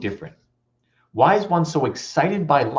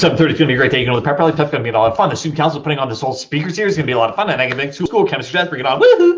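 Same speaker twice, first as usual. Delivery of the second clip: overexcited morning person; 5 dB louder, much faster than usual. Retold or imitated. Eager student greets the school day with a wild whoop. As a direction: bounce off the walls with glee and cheer it. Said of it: "they're kinda like this".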